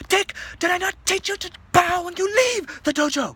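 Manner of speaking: very high, loud outraged master yell; master's shout. Acted. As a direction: scold a departing pupil at full volume. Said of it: "the Master Zo shouts".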